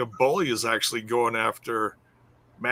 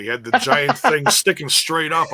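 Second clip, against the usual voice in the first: normal talking voice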